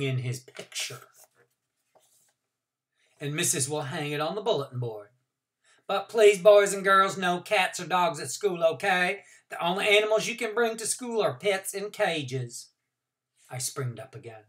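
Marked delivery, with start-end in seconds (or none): 5.9-12.7 higher, louder classroom warning; teacher's instructing voice. A narrator's reading. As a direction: set the rules for the class kindly. Teacher addresses class with rules.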